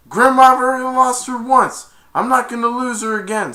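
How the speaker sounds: high, loud heroic insistence; determined cry. Retold or imitated. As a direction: shout back with urgent resolve. Prince insists on rescuing her.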